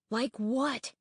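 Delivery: usual speaking voice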